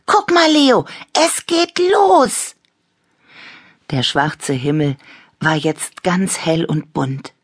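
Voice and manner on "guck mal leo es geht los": very high, loud cheer; excited call. A narrator's reading. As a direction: call a friend over excitedly. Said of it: "rief Annabella".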